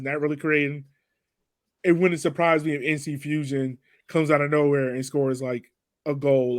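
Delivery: usual speaking voice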